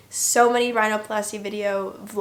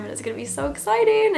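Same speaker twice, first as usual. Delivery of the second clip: higher, quicker, mildly excited self-talk; excited anticipation; 7 semitones higher, faster than usual. Retold or imitated. Promising herself a first makeup day, mildly excited. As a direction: lift the pitch and hurry with anticipation.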